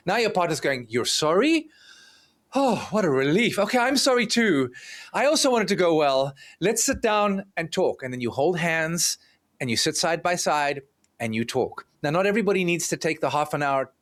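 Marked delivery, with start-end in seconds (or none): 0.9-8.0 relieved surprise. Retold or imitated. Partner relieved by apology.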